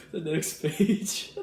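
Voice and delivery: slow, high words through laughter; laughing. Real, not acted. Vlogger announcing the booklet's next page, laughing too hard to speak normally. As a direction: squeeze the words out while cracking up.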